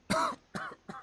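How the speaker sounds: high acted coughing fit; poisoned coughing. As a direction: cough as if poisoned mid-sentence. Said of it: "I'm still poisoned".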